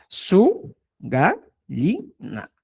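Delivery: "Su gallina" very slowly, each syllable separated. Shown as a demonstration. Teacher giving the translation of hualpan, syllable by syllable. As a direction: dictate "Su gallina" in drawn-out pieces.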